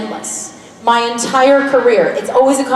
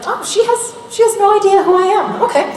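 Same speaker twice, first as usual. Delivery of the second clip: a higher, mock-clueless retelling of his words; lightly voiced Jensen, pitched up; imitating Jensen; 11 semitones higher than usual. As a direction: play him musing that she has no idea who he is. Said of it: "and he's like".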